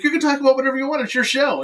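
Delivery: high, fast reply; rushed, emphatic go-ahead; retold insistence. Retold insistent answer to guest.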